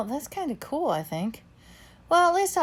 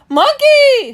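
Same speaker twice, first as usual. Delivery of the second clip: high, loud terrified scream; 14 dB louder than usual. A narrator's reading. Orange Cat sees the giant ape and screams in terror. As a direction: shriek in panic. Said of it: "screams Orange Cat".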